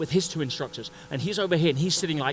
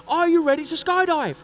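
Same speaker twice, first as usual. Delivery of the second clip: high, loud shout over the engines; instructor shouting; 11 semitones higher, 6 dB louder than usual. Retold or imitated. Instructor yells to the first-timer over the engines, loud. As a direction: bellow the question over the plane noise.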